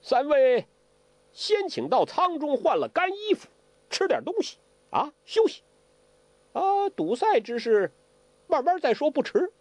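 very high, unhurried invitation; Ouyang Feng. Acted. Ouyang Feng invites three guests to change and eat, high-pitched and unhurried.

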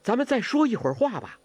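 high-pitched, urgent call to stay; calling after her. A narrator's reading. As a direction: call her back as she leaves. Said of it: "完颜康叫".

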